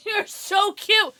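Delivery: high, slow, loud cooing. Real, not acted. Owner cooing at her dog, high and slow. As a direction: coo at the dog lovingly.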